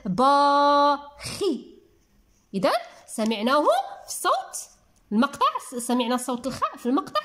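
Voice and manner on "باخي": با stretched very long, then a short خي; syllable by syllable. Shown as a demonstration. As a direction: hold با slowly, pause, then خي. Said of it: "ركزوا جيدا".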